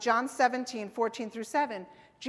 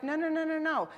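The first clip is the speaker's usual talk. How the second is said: quick, higher-pitched run of no's; fatherly objection; 5 semitones higher than usual. Acted. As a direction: jump in to stop a child. God acted as a protective father objecting.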